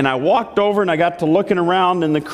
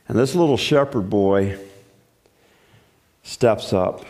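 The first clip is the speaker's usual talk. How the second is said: normal talking voice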